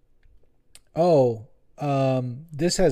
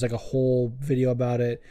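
ordinary talk, nothing marked

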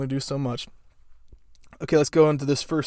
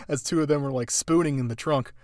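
ordinary talk

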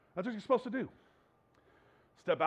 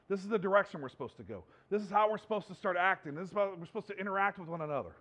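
ordinary delivery

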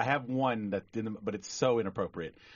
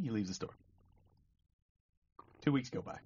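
ordinary talk

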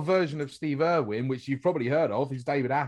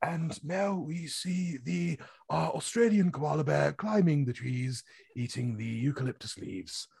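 David Attenborough; 6 dB quieter than usual. Acted. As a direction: narrate wildlife softly and unhurriedly. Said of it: "his voice is, like".

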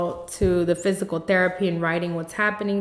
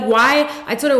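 ordinary delivery

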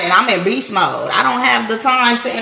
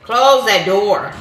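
"Close that door" dragged out slowly, word by word; slow emphatic order; much slower than usual. Real, not acted. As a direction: mom repeating the order slowly. Repeated order, slowed.

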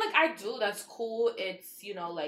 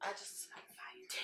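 breathy whispered aside; 15 dB quieter, slower than usual. Real, not acted. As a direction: whisper it to yourself. Quiet aside.

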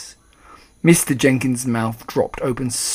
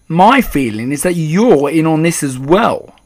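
higher, louder accusation; rude and angry; 4 semitones higher, 8 dB louder than usual. A narrator's reading. Father accusing the grandmother, rude and angry. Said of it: "He spoke rudely and he looked very angry".

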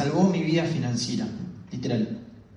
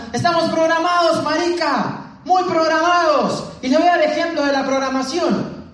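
shouting speaker; 11 semitones higher than usual. Retold or imitated. Colombian speaker shouting at the audience, fired-up yell. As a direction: whip up the crowd with a yell. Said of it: "él gritando".

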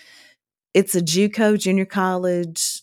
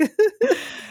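high laugh. Real, not acted. Laughing at dad's reaction.

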